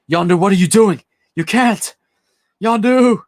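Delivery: high, loud desperate cry of a name; anguished shout. Retold or imitated. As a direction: scream after him as he flies off. Quill screaming as Yondu dies.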